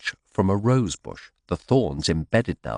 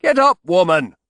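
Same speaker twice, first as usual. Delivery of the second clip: loud, high angry order; snapped; 12 semitones higher, 8 dB louder than usual. Acted. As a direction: snap the command down at her. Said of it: "he snapped at Tamsin".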